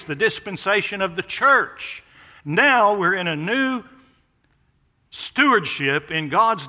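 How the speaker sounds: building preaching. Acted. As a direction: announce the new age with swelling force.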